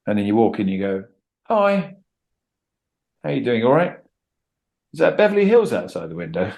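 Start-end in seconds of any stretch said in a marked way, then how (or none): 1.4-6.5 friendly breezy greeting. Retold or imitated. Acting out friendly entrance.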